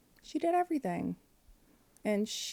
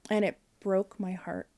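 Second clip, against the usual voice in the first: ordinary talk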